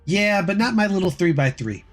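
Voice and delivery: ordinary delivery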